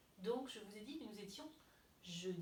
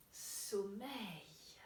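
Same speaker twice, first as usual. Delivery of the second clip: drawn-out, whispered drowsiness; sleepy whisper; 3 semitones lower than usual. Pretend. Teacher acts still sleepy for the children, whispering. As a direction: breathe the word out in a whisper.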